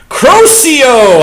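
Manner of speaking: mock spellcasting shout; shouted spell. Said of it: "I shouted".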